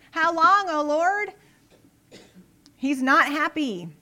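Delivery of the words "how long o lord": "How long, O Lord?" high and loud, rising on "long"; the right way: a raised, loud complaint; negative cry. Shown as a demonstration. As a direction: hurl "How long, O Lord?" up at God loudly. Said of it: "He starts out with a negative".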